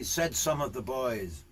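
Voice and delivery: ordinary delivery, nothing marked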